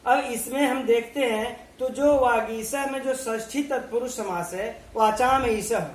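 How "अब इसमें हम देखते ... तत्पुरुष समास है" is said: usual speaking voice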